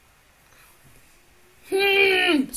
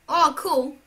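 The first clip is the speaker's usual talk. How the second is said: ordinary talk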